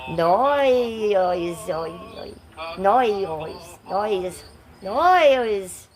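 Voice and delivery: Australian "no" stretched into a drawn-out "noise" with a buzzing z; mock Australian no. Shown as a demonstration. Teasing Australian viewers, a hammed-up Australian no. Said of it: "do the Australian no for you".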